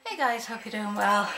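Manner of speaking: normal talking voice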